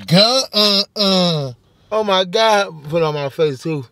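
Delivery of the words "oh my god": ordinary delivery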